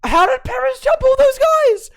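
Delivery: high, loud amazed yell; astonished shout. Real, not acted. Fan amazed Perez jumped everyone, astonished shout. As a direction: shout it out in disbelief.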